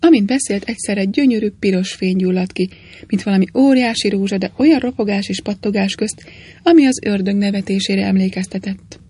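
usual speaking voice

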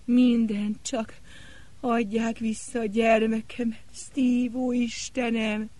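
high, breaking, desperate plea; cracked, pleading voice. Acted. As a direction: beg through a cracking voice. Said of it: "megcsuklott a hangja".

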